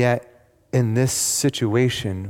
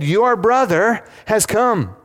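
excited announcement; 10 semitones higher, 4 dB louder, slower than usual. Acted. Servant announcing brother's return.

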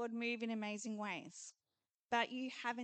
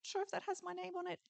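higher, soft, quick and unsure; tentative believer; 7 semitones higher, 6 dB quieter, much faster than usual. Retold or imitated. Believer unsure the promise is his, high soft voice. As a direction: mumble it fast and doubtful. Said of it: "tentatively going up, going".

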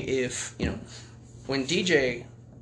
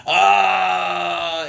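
mock dying scream; 4 semitones higher, 7 dB louder than usual. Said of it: "they wrote".

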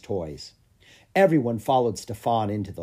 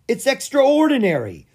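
much higher, louder wonder; amazed exclamation; 14 semitones higher, 8 dB louder than usual. A narrator's reading. Andre seeing the hatchling, high amazed exclaim. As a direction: gasp it out in awe. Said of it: "said Andre".

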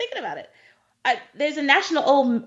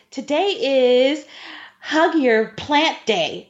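slow mock disbelief; 2 semitones higher, much slower than usual. Mocking silly national day.

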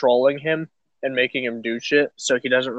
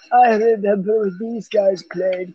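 put-on old man voice; 6 semitones higher than usual. Old self reminiscing at retirement home.

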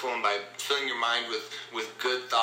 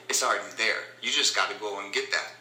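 ordinary talk

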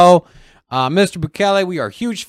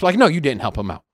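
normal talking voice

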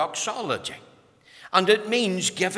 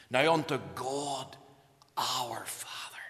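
softer, falling to a hushed whisper on our Father; whispered scripture; 4 semitones lower, 7 dB quieter, slower than usual. Shown as a demonstration. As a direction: let the verse fade into a reverent whisper. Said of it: "quoted text, whispered".

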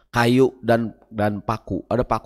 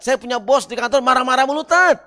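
whiny complaint; 9 semitones higher than usual. Acted. Complaining congregant to ustadz.

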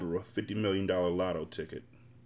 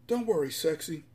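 higher-pitched character voice, gently coaxing; patient, reassuring boyfriend; 6 semitones higher than usual. Acted. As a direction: coax a nervous partner to trust you. Bullet reassures her while swimming.

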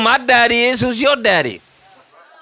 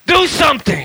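high-pitched mock command; retold exasperated plea; impatient demand; 7 semitones higher than usual. Re-enacting impatient demand to God.

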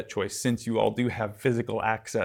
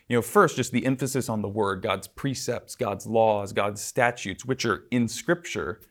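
ordinary talk, nothing marked

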